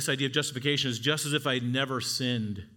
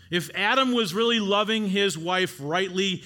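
louder, higher earnest plea, slowing on the last words; Adam pleading; 7 semitones higher, 6 dB louder than usual. Acted. Adam pleading with God to spare his wife, loud earnest plea. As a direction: beg God to spare her. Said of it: "he would have said".

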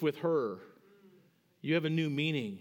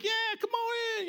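much higher, bright mock welcome; welcoming coaches. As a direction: imitate the coaches waving him in. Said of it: "And they're like".

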